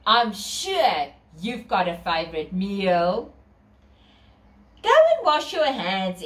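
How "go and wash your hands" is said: calling out. A narrator's reading. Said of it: "she calls".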